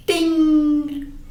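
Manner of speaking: high ringing bell sound; bright imitated bell; shop bell ding. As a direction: ring like a shop door bell.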